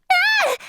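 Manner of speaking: high, sudden yelp of alarm; startled cry. Acted. Fearing another fight, startled high yelp. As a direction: cry out as if caught off guard.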